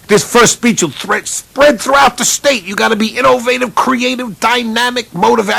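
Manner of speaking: high, excited booster pep talk; boosters' high, hyped instructions for the new coach; booster club voice. Retold or imitated. Booster club giving him orders.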